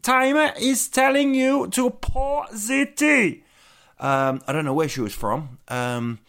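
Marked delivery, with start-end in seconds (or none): 0.0-3.4 high, slow, imitating a strict German lady; mock-stern high voice laying down the rule; stern German waitress. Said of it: "she brought out an egg timer and said".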